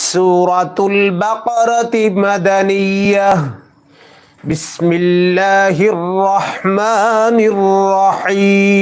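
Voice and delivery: slow, measured Quranic chant; slow melodic tilawah; Quran recitation. Shown as a demonstration. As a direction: recite with full tajweed.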